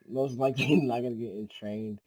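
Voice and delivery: real laugh breaking into the words. Real, not acted. Forecaster distracted by an annoying dog mid-update, laughing. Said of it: "I'm laughing 'cause my dog".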